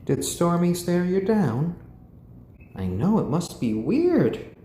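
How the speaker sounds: cooing to a pet. Real, not acted.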